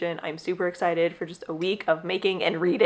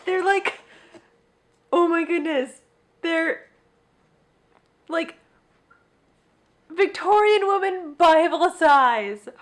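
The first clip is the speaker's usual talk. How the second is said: high; 8 semitones higher than usual. Real, not acted. Cooing over tiny books.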